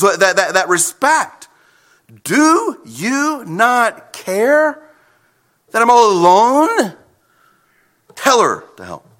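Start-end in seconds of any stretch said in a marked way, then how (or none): 2.2-9.1 sassy. Acted. Martha complaining to Jesus about her sister, sassy. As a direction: put sass into the complaint, stretching the opening words. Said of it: "There's a little sass in the tone".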